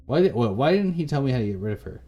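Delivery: ordinary delivery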